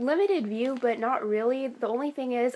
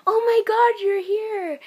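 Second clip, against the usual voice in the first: high, loud burst of delighted surprise; excited greeting; 12 semitones higher, 8 dB louder, slower than usual. Retold or imitated. Castmate spotting a fan at the stage door, excited greeting. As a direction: gush it loudly, pitched way up.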